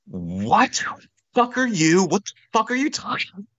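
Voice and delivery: high, squawking outraged disbelief; losing his mind. Retold or imitated. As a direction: imitate a drummer flipping out at the news. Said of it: "Like, lost his mind".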